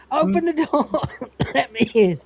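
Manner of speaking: high shout cracking into laughter; laughing call. Retold or imitated. Locked out, shouting through laughter for the door. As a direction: beg to be let in while giggling.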